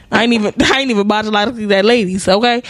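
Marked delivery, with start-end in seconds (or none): none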